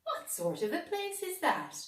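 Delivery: high curious cat; the cat wondering about the market. A narrator's reading. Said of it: "said Cligging".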